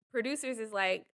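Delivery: usual speaking voice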